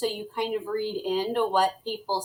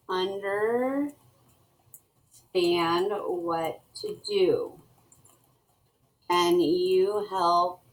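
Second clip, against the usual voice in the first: slow dictation; much slower than usual. Shown as a demonstration. Teacher dictates email while typing.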